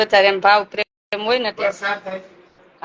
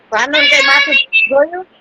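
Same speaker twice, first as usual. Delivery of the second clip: much higher, louder call with a rising end; high calling question; 12 semitones higher, 4 dB louder than usual. Real, not acted. Grandma calling out on the video call, asking about Bhanu, high and rising. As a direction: pitch it up as if calling from far away.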